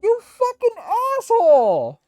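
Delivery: real gamer rage yell; furious shout. Real, not acted. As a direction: scream at the game after losing everything. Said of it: "Oh, I'm so mad!".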